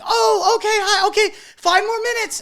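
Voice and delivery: squeaky high, flustered photographer; high-pitched mock photographer begging for time. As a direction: play her as caught off guard and stalling.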